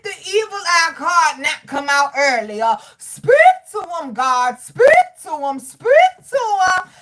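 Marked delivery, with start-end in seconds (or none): 3.0-6.9 pleading call, higher and louder; urgent pleading call to heaven. Real, not acted. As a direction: cry out to God to speak. Calls out to God, preaching.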